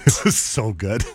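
real laughter through the words, drawn out; slow, breaking up with laughter; laughing. Real, not acted. Host recalling walk of shame to co-host, laughing. Both hosts laughing at memory.

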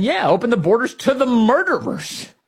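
mock Elijah Manley. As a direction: parody him as gleefully reckless. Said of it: "Elijah Manley saying".

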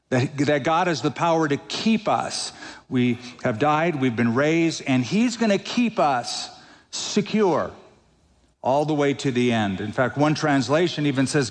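ordinary talk

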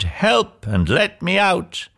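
high, drawn-out cry for rescue; bellowing for help. A narrator's reading. Trapped Cinderella cries out to be freed, bellowing. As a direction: call out slowly at a high pitch. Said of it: "She bellowed".

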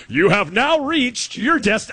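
high, slow, grand GPS announcement; Jeff Jarnigan. Retold or imitated. Jeff as your GPS voice, slow hammed-up announcer. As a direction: draw it out like a booming announcer. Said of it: "if Jeff was your G P S voice".

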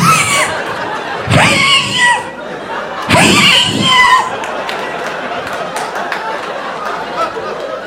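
long high strangled wheezes; drawn-out strained gasping, pitched very high; dog choking noise. Retold or imitated. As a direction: mimic a dog choking itself on the leash. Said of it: "He would be choking himself".

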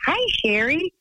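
high, sing-song police greeting; friendly cop greeting. Retold or imitated. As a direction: greet her cheerily like a polite cop. Cops greet her at the door.

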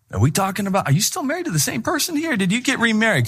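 high, disbelieving mock reader; incredulous reader. Readers mocking a gushing post, high and incredulous. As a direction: play a baffled reader who can't recognize the spouse. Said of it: "they're like".

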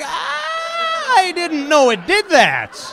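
slow, loud, high amazed shout; drawn-out disbelief at what he just saw; stretched-out shocked outburst; stunned shock. Retold or imitated. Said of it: "and I'm like".

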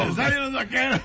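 laughing. Pretend. Mock commentary, laughing.

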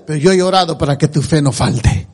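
voz más grave y serena, citando al Señor; el Señor intercediendo. Retold or imitated. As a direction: cita en tono bajo y consolador. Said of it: "Pero luego decía". (palabras del Señor, imitadas en tono grave)

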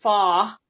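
"fa" with no R, just an open ah; Boston R-dropping. Shown as a demonstration. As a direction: drop the R: "fa". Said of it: "he drops the R on four".

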